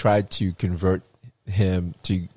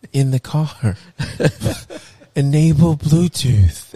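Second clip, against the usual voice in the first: mocking; 6 semitones higher than usual. Mocking father-in-law's phone use.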